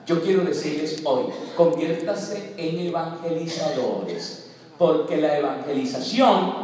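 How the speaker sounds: usual speaking voice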